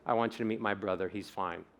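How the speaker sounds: low, soft and rushed, no feeling; flat mumble. Shown as a demonstration. Speaker demos a flat delivery to the amygdala. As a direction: toss it off flat and quick. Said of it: "So if I say".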